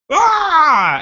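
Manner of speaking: loud high shriek; panicked scream. Retold or imitated. Manager's wordless scream down the phone. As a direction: scream in alarm. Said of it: "He just screamed".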